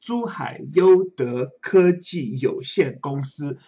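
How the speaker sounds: slow, syllable-by-syllable "Zhuhai Youde Keji Youxian Gongsi"; careful Chinese pronunciation. Shown as a demonstration. Host reading the company's Chinese name, "Zhuhai Youde Keji Youxian Gongsi" sounded out slowly. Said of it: "we had to pronounce everything correctly".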